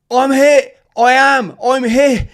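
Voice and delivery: high, loud desperate cry; desperate shout. Retold or imitated. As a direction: call out as if shot and scared. Wounded soldier calling out.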